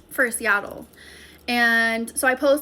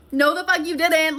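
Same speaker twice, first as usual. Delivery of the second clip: louder, higher, furious comeback; angry retort; 5 semitones higher, 6 dB louder than usual. Retold or imitated. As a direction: snap it back at the liar, heated. Yelling at her lying ex.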